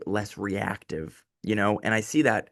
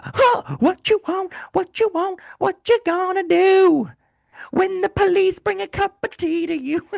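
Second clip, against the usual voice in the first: very high, loud put-on cop voice; squeaky cartoon-copper voice, hammed up; mock British cop; 5 dB louder, slower than usual. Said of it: "could never be a show in the United Kingdom".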